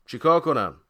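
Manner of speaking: loud, high helpless question; exasperated. A narrator's reading. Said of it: "دستی به پیشونی کشید".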